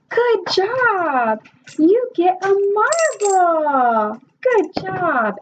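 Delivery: teacher praise. Shown as a demonstration. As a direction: reward the child warmly. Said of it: "praising student demo".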